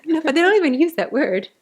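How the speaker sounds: excited. Real, not acted. Laughing excited protest.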